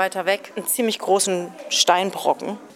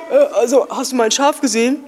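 stranger's voice; 4 semitones higher, 6 dB louder than usual. Acted. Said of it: "kommt ein Mann und sagt".

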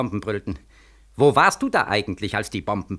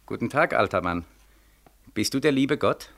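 ordinary delivery

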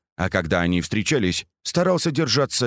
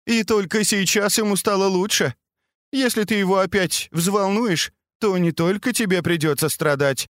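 lightly voiced worried mother, higher; 9 semitones higher than usual. The aunt pleads with daughter.

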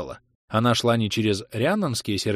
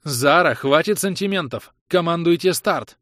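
loud, higher barked order; sharp shout; 8 semitones higher, 5 dB louder than usual. Acted. Gwyneth yells at Zara to launch, loud and sharp. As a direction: snap her out of it. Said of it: "прикрикнула на неё Гвинет".

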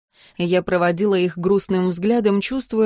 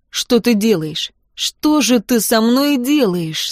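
usual speaking voice

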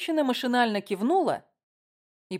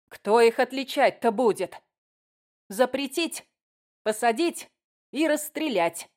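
raised, higher voice of outrage; mock angry outcry; 4 semitones higher than usual. A narrator's reading. Committee woman at the front row, indignant outcry. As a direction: hand down harsh demands, pitch lifted. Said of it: "закричала женщина из комитета".

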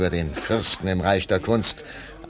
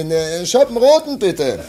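raised voice ordering wine, with a small laugh; calling to the waiter; 7 semitones higher, 6 dB louder than usual. Acted. Man calls to the waiter for red wine, raised voice. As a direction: call out for a glass of red.

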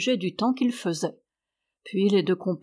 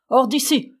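loud, higher angry order; cried out; 5 semitones higher, 6 dB louder than usual. A narrator's reading. Old man turning his animals out, shouting. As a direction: throw them out with a sudden cry. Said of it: "cria-t-il".

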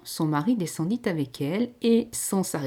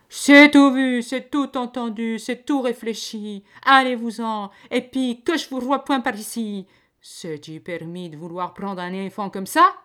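much louder and higher, cutting in; exasperated outburst; 6 semitones higher, 9 dB louder than usual. A narrator's reading. Peasant woman cutting off the lady and throwing them out, exasperated. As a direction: shout them out, fed up. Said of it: "exaspérée, lui coupa la parole".

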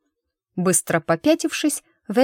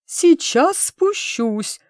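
high, slow, wheezing squeak; wheezy squeak; 10 semitones higher, 3 dB louder, much slower than usual. Acted. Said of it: "просипел Вейрон".

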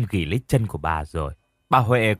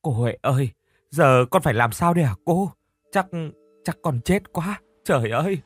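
pleading; 4 semitones higher than usual. A narrator's reading. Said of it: "rồi nài nỉ".

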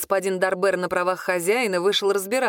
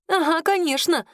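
put-on high, sarcastic girlish retort; unstoppable indignation; 9 semitones higher than usual. A narrator's reading. Claudine won't let her rival explain, high and indignant. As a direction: throw it back, pitched up. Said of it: "Клодина уже было не остановить".

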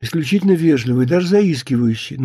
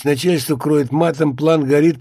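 ordinary delivery, nothing marked

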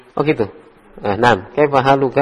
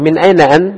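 MIN AINA AN higher and louder, pitch lifted on AINA; emphatic question tune; 4 semitones higher, 6 dB louder than usual. Shown as a demonstration. Teacher contrasting tunes for students, emphatic high question on "Min aina an". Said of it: "Ya kan beda".

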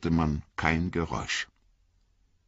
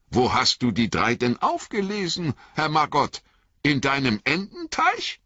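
Merry; 8 semitones higher than usual. Acted. Merry's cheerful speech.

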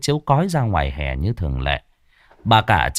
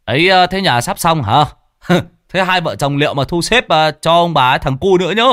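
teasing banter; 7 semitones higher, 4 dB louder than usual. A narrator's reading. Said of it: "tiếng đùa cợt".

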